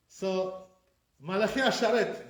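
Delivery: usual speaking voice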